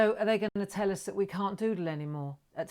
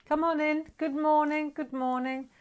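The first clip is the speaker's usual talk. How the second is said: high, louder call-in greeting on held notes; sing-song welcome; 9 semitones higher, 3 dB louder than usual. Real, not acted. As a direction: call them in with a chanted lilt. Calls arriving viewers in.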